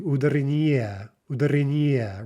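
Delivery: "ударение" with the stress pushed late onto ни; misplaced stress. Shown as a demonstration. Teacher struggling through a deliberate mistake, "ударение" wrongly stressed on ни. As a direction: hit НИ near the end of "ударение". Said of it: "So those are all incorrect".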